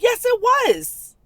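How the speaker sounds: very high, drawn-out contradiction; insistent retort. Retold or imitated. Woman contradicting the man about how bad it was, insistent. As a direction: stretch it out high in exasperated insistence.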